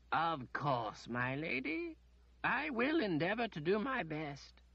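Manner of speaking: a lightly voiced, patient old wizard; the wizard calmly answering the demanding lady. A narrator's reading. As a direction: humour her politely and slowly. Wizard humours her.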